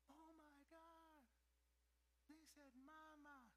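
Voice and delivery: slow, awed gasp of a new parent; amazed parent. Retold or imitated. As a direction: savour the first word in disbelief. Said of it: "We're freaking out".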